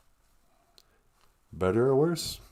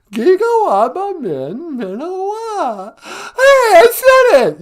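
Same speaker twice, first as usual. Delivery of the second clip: Giga waba min, minowa squealed much higher and louder, minowa slow; mock [name] attempt; 9 dB louder, slower than usual. [name] repeats the goodbye for Nana, excited squeal. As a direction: ham up a learner's clumsy try.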